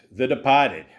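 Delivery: Boston accent, dropping the R in Departed; mock Boston pronunciation. Shown as a demonstration. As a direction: say "Departed" as "De-pah-ted", like a Bostonian. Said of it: "you gotta say it with ... the Boston accent".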